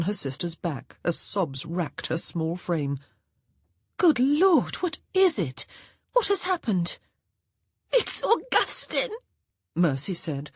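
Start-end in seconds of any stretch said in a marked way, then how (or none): 4.0-7.0 high worried questions; urgent, frightened concern; alarmed. A narrator's reading. Grace alarmed. 7.9-9.2 put-on high tearful child's voice; sobbing. A narrator's reading. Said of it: "gasping through her sobs".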